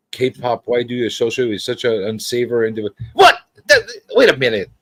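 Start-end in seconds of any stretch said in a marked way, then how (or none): 3.1-4.7 high, hammed-up offended protest; mock indignation. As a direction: object as if deeply insulted, in play. Mock offence at being called unsavory.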